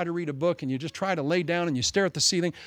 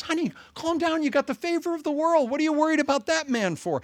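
much higher, coaxing wife voice; pitched-up mock wife, gently scolding; soothing wife; 11 semitones higher than usual. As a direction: play the wife calming her husband. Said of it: "And his wife goes".

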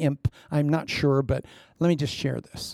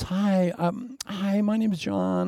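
awkward introduction; 5 semitones higher, slower than usual. Retold or imitated. Awkward approach to a stranger.

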